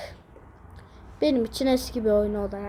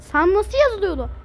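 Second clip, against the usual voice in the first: frustrated outburst; 7 semitones higher, 6 dB louder than usual. Real, not acted. Frustrated spelling question.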